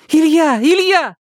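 high, loud, frightened calling of a name; panicked cry. Acted. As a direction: scream his name in terror. Vera cries for help.

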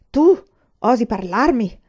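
slow, loud outraged challenge; angry roar. A narrator's reading. Sergeant flushed with rage rounds on the student, roaring. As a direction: roar it, drawing out the affront. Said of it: "ruggì".

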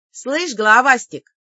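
loud cocky call; taunting shout. Acted. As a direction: call out to provoke him. Heroine taunts the demon.

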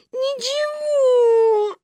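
high, very drawn-out wail; sobbing child. A narrator's reading. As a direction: bawl it out slowly like a little girl. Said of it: "рыдала Маня". (рыдая, высоко и протяжно)

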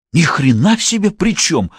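high-pitched indignant outburst; exploding. A narrator's reading. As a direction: blow up at the objection. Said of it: "опять взорвался Белов". (взрываясь от возмущения)

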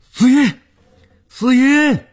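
high, loud frantic shouts; repeated calling out. A narrator's reading. Stumbling downstairs calling for her, high frantic shouts. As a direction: keep shouting the name, high and urgent. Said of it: "不断地叫着".

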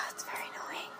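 sigh, then whisper it fast. Real, not acted. Complaining to cohost about Donna, sighed whisper. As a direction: breathe it out like a tired aside. Sighs then repeats louder.

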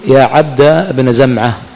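يا عبد بن زمعة with fatha, 'ʿabda bna'; slow 'yā ʿabda bna zamʿa'; فتح الجزئين; fatha on both parts. Shown as a demonstration. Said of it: "نبني على فتح الجزئين".

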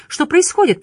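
high, anxious woman's question; worried high voice; Liza's distant cry. A narrator's reading. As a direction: call out in alarm, pitched well up. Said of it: "отдалённый голос Лизы".

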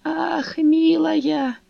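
put-on flustered old man. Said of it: "сконфуженно".

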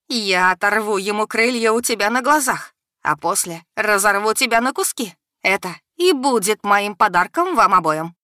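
loud, menacing goddess; menacing threat. A narrator's reading. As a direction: promise her death. (злобная угроза)

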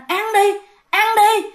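high-pitched haunting taunts; force-feed the victim with your voice, pitched high; acted-out vengeful female ghost; menacing ghost woman. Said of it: "Bà đưa vô cái miệng mình nói".

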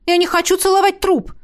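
high, heated protest; cross girl snapping at a ghost; angry. Acted. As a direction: refuse it hotly. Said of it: "рассердилась Миранда".

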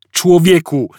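high, loud exasperated protest; Arthur protesting. A narrator's reading. Arthur to Ford, loud high protest. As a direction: object in exasperation. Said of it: "zaprotestował Artur".

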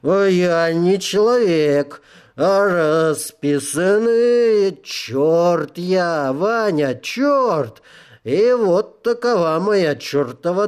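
put-on high, slow, slurring drunk devil; whining tipsy devil lamenting his lot.

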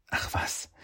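breathy hushed brush-off; soft whispered reassurance. Acted. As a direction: whisper it, waving the worry away. Shen brushes off apology softly.

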